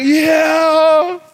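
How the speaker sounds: high, loud eager yeah; a gullible fan shouting yes. Acted. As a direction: blurt it out, fully taken in. Voicing a duped listener.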